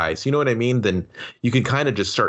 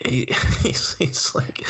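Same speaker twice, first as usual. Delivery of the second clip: laughing whisper; 4 semitones higher, slower than usual. Real, not acted. Laughing whisper while retelling.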